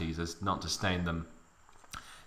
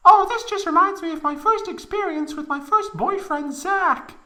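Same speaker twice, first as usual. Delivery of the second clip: shrill falsetto reminiscing; high Aeris; 5 dB louder than usual. A narrator's reading. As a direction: recall it in a squeaky girl's voice.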